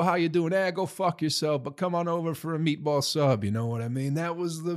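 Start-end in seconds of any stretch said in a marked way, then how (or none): 0.0-3.4 put-on a light New Jersey Italian accent, a bit higher. Vlogger imitating a friendly New Jersey local, light Jersey accent.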